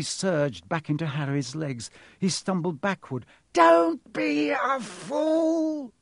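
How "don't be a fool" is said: very high, loud, drawn-out snarl. A narrator's reading. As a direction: snarl each word long and high. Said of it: "snarled the face".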